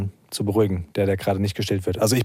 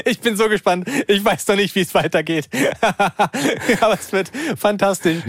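high, laughing excitement; giddy excitement; 8 semitones higher than usual. Real, not acted. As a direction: bubble over with glee.

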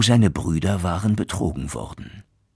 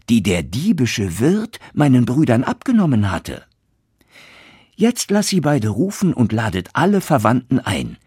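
higher, louder eager boasting; excited son; 8 semitones higher, 4 dB louder than usual. Acted. Son excitedly telling father to invite all relatives, high and loud.

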